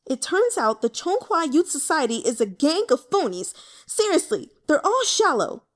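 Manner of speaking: high, loud scorn; indignant rant. Acted. Youth denouncing his phony club in a loud, high rant. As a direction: sneer at the whole gang. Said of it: "the angrier he got".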